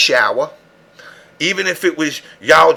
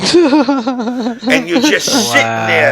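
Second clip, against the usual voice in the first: laughing; 8 semitones higher, much slower than usual. Real, not acted. Laughing while telling.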